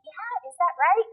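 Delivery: put-on high, slow baby-talk question; playing along with babbling. Shown as a demonstration. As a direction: pretend to understand a baby's babble. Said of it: "pretend you know what they're saying".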